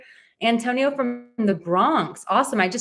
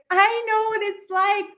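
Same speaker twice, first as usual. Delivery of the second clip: much higher, cutesy, sing-song voice for the dog telling its sad story; speaking as the dog; 12 semitones higher than usual. Pretend. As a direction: play the rescue dog, high and sweet, with mock tears halfway. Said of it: "Abby's like".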